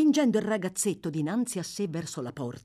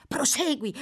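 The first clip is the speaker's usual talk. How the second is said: calling out; 11 semitones higher, 3 dB louder than usual. A narrator's reading.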